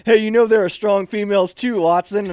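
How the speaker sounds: barked rebuke, acted out; drill sergeant's shout. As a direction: call a trainee out like a drill sergeant. Drill sergeant calls out the trainee.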